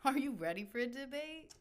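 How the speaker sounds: ordinary delivery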